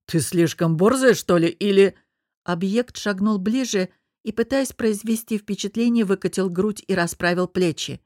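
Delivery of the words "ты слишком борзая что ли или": louder, confrontational man; irate challenge. A narrator's reading. Angry man challenges the cheeky woman, confrontational. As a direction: square up to her, irate.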